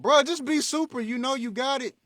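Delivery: high, loud urging; loud, high fan cheering him on; exasperated yell at Superman. Retold or imitated. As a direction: shout at the screen for him to get it together. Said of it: "you be all like".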